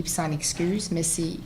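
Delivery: ordinary delivery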